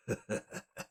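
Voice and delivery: low, breathy chuckle under the breath; soft, menace-tinged villain's laugh. Acted. Alchemist sizes up an old acquaintance, quiet chuckle. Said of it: "he chuckles for a second".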